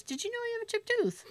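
hygienist voice. Retold or imitated. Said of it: "she goes".

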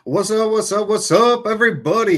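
loud, high hype-man welcome; hype greeting. Real, not acted. Host revving up the crowd at the show's start, loud and high. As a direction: pump up the audience to open the show. Host hyping the show opening.

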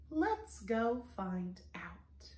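slow, soft whisper; secretive, drawn-out hush; hushed invitation. Acted. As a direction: whisper it to draw listeners in. Storyteller draws children into secret.

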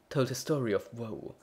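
usual speaking voice

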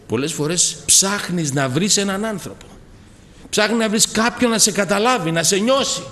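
loud, high, fervent preaching; preaching at full force. Acted. Preacher praising Jesus to the congregation, full force. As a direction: lift the whole church with it.

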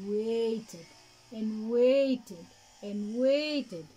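slow, stretched repetition of waited; drawn-out waiting. A narrator's reading. Narrator stretching the long wait. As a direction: let each waited drag longer.

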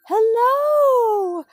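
plain reading voice

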